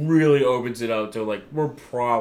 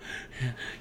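soft gasp; 12 dB quieter than usual. Retold or imitated. Jay's reaction in the ring.

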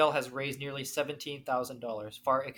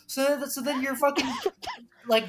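usual speaking voice

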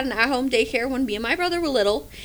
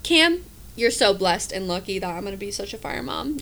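usual speaking voice

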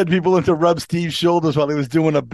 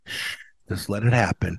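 soft, low shush into someone's ear; hushed, low, coaxing murmur; creepy whisper; 8 semitones lower, 6 dB quieter than usual. Retold or imitated. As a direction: mimic a creepy coworker whispering low and close. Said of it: "he'd start whispering in your ear".

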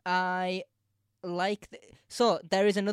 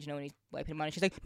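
ordinary talk, nothing marked

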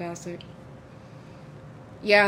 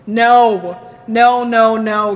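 emphatic repeated no, getting louder; 11 dB louder, much slower than usual. Real, not acted. Friend refusing the book tattoo, loud mock-horror no.

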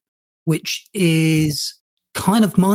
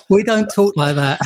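ordinary delivery

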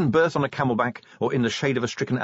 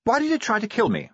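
high-pitched, urgent question; high character voice; 14 semitones higher than usual. A narrator's reading. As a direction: pitch it far above the narration. Attack victim questioned in story.

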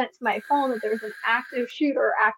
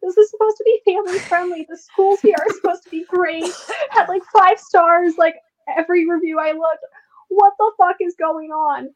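higher and louder, incredulous rant; exasperated disbelief; 7 semitones higher, 5 dB louder than usual. Retold or imitated. Mother shocked by a swatting nearby, exasperated rant. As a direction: rant in disbelief about the neighborhood. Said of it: "And I'm like".

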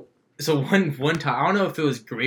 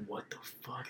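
whispered, puzzled aside to oneself; retold baffled whisper; whispered disbelief; 22 dB quieter than usual. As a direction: say it under the breath, voiceless. Retold private surprise at finding food.

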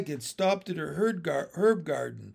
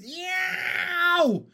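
long high "yow" howl; comic cartoon yowl; pained yowl; 13 semitones higher, 3 dB louder than usual. A narrator's reading. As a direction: yelp drawn out in pain. Lands in thorn branches.

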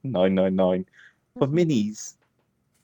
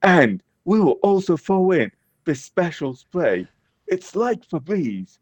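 infomercial; 8 semitones higher than usual. Pretend. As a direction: pitch the bonus gift. Infomercial bit continues.

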